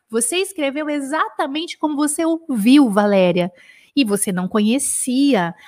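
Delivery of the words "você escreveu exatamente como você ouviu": ordinary talk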